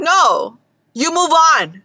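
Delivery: ordinary talk, nothing marked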